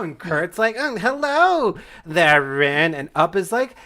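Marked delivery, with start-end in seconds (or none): 0.8-2.9 high, slow, loud hello; put-on schoolmate's hello; greeting classmate. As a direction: open high and drawn out, then drop on the name. Classmate Kurt greets Rin.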